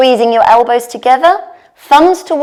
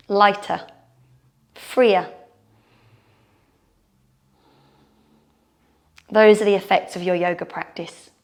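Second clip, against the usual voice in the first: guided meditation; 3 semitones lower, 7 dB quieter than usual. Shown as a demonstration. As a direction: settle them into calm closing. Closing relaxation of yoga class.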